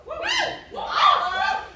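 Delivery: high, loud cry of love; exuberant shout. Acted. Performer declares her love of women to the audience, exuberant shout. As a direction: shout it out to the crowd.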